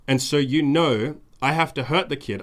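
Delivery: ordinary talk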